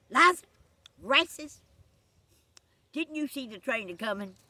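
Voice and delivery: two loud, high names with a gap; sharp calling out. Acted. Conductor jumping off the train at the couple, sharp calling out. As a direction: call each name like a scolding.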